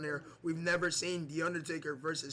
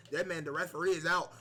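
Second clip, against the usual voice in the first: ordinary talk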